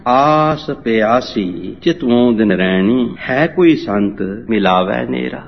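slow, drawn-out model reading of the verse; slow demonstration recitation; measured scripture reading. Shown as a demonstration. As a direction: show a student how to read it, stretching the words. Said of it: "ਇਸ ਤਰ੍ਹਾਂ ਪੜ੍ਹਨਾ".

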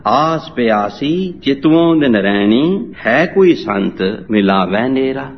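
slow model recitation with stretched vowels; unhurried, drawn-out verse demonstration; measured scripture reading. Shown as a demonstration. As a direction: teach the reading, drawing each phrase out slowly. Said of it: "ਇਸ ਤਰ੍ਹਾਂ ਪੜ੍ਹਨਾ".

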